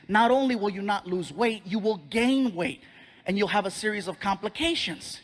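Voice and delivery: normal talking voice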